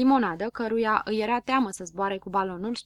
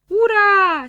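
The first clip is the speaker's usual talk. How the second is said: much higher, much louder hurrah; cheering shout; 11 semitones higher, 12 dB louder than usual. A narrator's reading. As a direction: yell it with all joy. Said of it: "strigară piticii". (piticii strigă ura, much higher and much louder)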